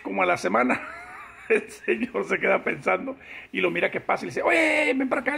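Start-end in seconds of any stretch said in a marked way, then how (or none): 4.4-5.4 calling out. Acted. Boss calls the clerk over.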